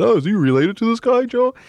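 high-pitched, put-on questioning voice; acted-out other guy. Voicing the caller in retold call.

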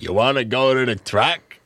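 big gruff voice, rising question; Mister Giammalvo. Acted. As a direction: holler the question like a rough old Brooklyn guy. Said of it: "gruff voice, talk like this".

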